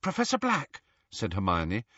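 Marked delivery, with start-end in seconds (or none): 0.0-0.8 high, gentle appeal; pleading. A narrator's reading. Hermione appealing to the departing portrait, pleading. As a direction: call to him coaxingly.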